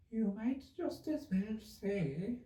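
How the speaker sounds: high, very slow sleepy voice; sleepy Dormouse. A narrator's reading. Dormouse talking in his sleep, high and very slow. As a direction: drift through it half asleep. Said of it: "talking in his sleep".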